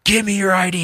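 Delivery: high-pitched retold demand; mock agitated police order; freaking-out cop. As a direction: imitate a cop losing it. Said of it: "he's, like, freaking out".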